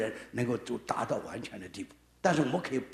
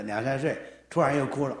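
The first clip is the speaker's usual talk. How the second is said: ordinary delivery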